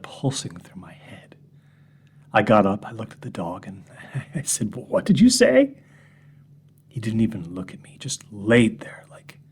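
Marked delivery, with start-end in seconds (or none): 4.7-5.8 high, loud, startled question to the dog; incredulous question. Retold or imitated. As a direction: blurt it out in disbelief. Asks the dog in disbelief.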